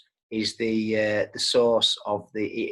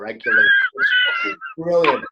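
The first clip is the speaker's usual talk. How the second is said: excited shout; 5 dB louder than usual. Real, not acted. Laughing over the wig look.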